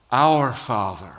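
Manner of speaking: "Our Father." said slowly, leaning hard on "Our"; stress on Our. Shown as a demonstration. Preacher answering his own question, stressing "Our". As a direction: land the word "Our" with slow weight. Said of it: "What does he say?".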